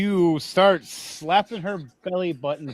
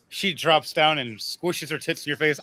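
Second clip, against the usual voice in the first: normal talking voice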